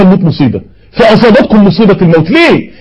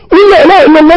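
rising urgent plea; 6 semitones higher, faster than usual. Retold or imitated. A passenger begging the dying man again, more urgent. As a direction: push harder each time.

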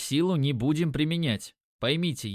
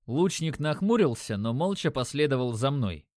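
ordinary delivery, nothing marked

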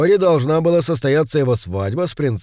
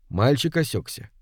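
ordinary delivery, nothing marked

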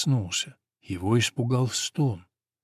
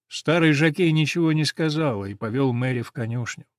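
plain reading voice

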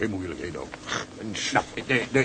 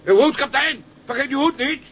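much higher, louder call after him; 7 dB louder than usual. Acted. Cook calls after the departing captain about his hat, loud call. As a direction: shout it after someone already leaving.

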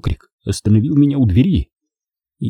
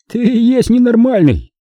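high, derisive exclamation; snorting retort; 13 semitones higher, 4 dB louder than usual. A narrator's reading. Driver retorts to his passenger, snorting. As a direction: throw it back high and scornful. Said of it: "фыркнул он".

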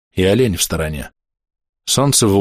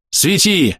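higher-pitched barked order; рявкающий приказ; 9 semitones higher than usual. A narrator's reading. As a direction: bark out a command. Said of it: "рявкнул доктор". (рявкнуть коротким приказом)

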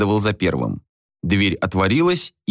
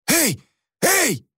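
high, sharp shouts for attention; acted alarmed holler; calling out. A narrator's reading. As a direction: shout to stop a brawl. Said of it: "закричал он".

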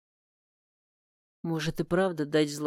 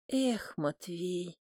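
disappointed sigh; 8 dB quieter, much slower than usual. A narrator's reading. Aunt sighs, waves him off.

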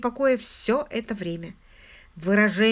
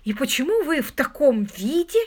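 compulsive questioning; 5 semitones higher, 4 dB louder than usual. A narrator's reading. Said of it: "но не могла остановиться".

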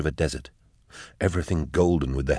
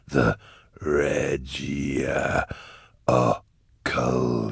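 deep demon stretching "Regia Occulta" syllable by syllable; Cherubael; 3 semitones lower, much slower than usual. A narrator's reading. Cherubael repeats the proper name, slow eerie relish. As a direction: savour "The Regia Occulta" very slowly, low.

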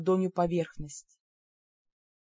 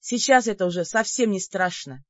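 ordinary delivery

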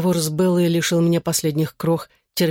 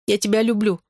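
higher, slightly tearful female voice; mild sob; 5 semitones higher than usual. A narrator's reading. Bella declares love to her ex, mildly sobbing. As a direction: confess with a touch of tears, pitched up. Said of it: "всхлипнула Белла".

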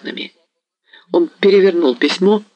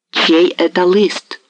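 a wild hetman roaring at the messenger; wild hoarse shout; 7 semitones higher, much slower than usual. A narrator's reading. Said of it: "закричал Гетман таким диким, хриплым голосом".

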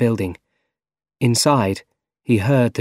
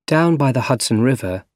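ordinary delivery

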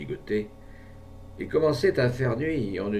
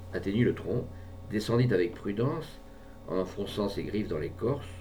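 ordinary delivery, nothing marked

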